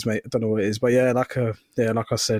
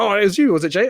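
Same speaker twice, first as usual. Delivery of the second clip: mock accusation; 5 semitones higher, 4 dB louder, faster than usual. Acting out others accusing him.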